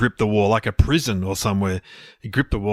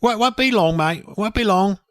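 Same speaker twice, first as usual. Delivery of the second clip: higher-pitched chirpy English workman; working-class gardener; 11 semitones higher than usual. Pretend. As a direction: call down cheerily as the gardener. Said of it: "I'll be his gardener".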